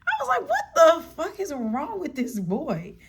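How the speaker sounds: high-pitched, laughing retold exclamation; laughing disbelief. Storyteller recalling her reaction to the date, laughing in high-pitched disbelief.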